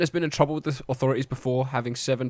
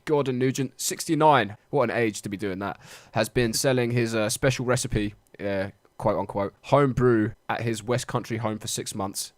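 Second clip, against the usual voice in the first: usual speaking voice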